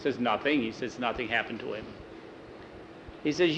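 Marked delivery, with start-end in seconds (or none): 0.2-1.9 evasive denial. Acted. Norbert evasively denies knowing.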